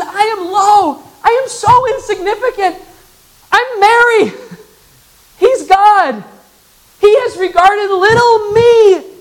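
put-on high, small voice of a humbled young Mary; voicing Mary. Preacher voicing Mary, awed and humbled. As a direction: speak as Mary, overwhelmed at being noticed.

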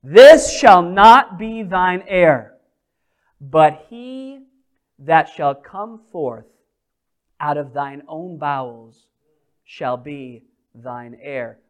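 loud, slow, weighty King James reading; solemn scripture reading. Acted. Preacher reading God's words to the church, slow and loud. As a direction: read the verse like thunder. Rereads verse slowly.

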